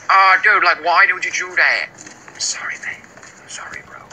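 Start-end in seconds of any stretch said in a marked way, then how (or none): 2.3-4.0 whispering, very soft sorry; hushed, voiceless apology; whispered apology. Real, not acted. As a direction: whisper an apology, fading away. Apologizes quietly to game character.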